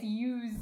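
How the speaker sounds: "use" said slowly as YOOZ; Z sound. Shown as a demonstration. Confirming the word for viewers, "use" as YOOZ. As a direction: hold "use" into a voiced z. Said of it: "with a Z sound".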